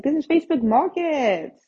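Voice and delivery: high, loud exclamation of disbelief; indignant outburst. Retold or imitated. Complaining to sellers asking too much on Facebook, indignant. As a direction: exclaim it in mock outrage.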